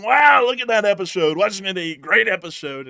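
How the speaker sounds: hammed-up fans praising; mock gushing. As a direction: gush over it. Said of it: "Everyone's been saying".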